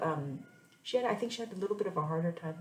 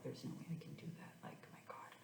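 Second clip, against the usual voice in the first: hushed inner thought; 4 semitones lower, 16 dB quieter, faster than usual. Retold or imitated. Thinking it over to herself.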